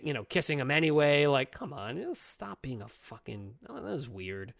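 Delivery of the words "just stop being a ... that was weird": normal talking voice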